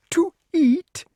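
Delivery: very high, loud alarmed question; stammering serpent. A narrator's reading. Snake asks if the human eats snakes, high alarmed stammer. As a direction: blurt it out in fright. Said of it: "stammered the serpent".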